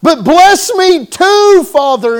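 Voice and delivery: high, loud, slow pleading cry to his father; crying plea. Retold or imitated. Esau begs his father for a blessing, crying. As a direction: beg through tears. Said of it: "he's crying and tears are flowing".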